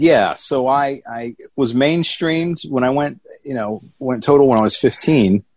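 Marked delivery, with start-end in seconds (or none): none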